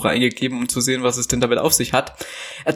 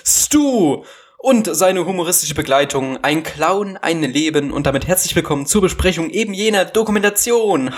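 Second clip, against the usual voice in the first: arena announcer. Acted. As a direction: announce like a ringmaster. Circus ringmaster intro for Stu.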